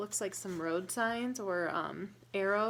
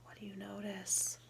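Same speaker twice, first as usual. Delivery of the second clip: soft whispered question; whispered storytime question; 7 dB quieter than usual. Real, not acted. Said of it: "whispered question to child".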